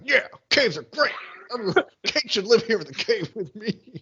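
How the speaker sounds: high-pitched mock enthusiasm; eager Jack. Podcaster mimicking Jack loving the caves, eager mock voice. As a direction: play it up, high and keen. Acting out Jack's eager lines.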